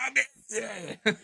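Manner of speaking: high hammed-up Australian old-timer with a laugh; old Aussie guy. Quoting the film's old guy to friends, hammed-up Australian voice. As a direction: drawl it like the film's outback codger. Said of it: "the old guy's like".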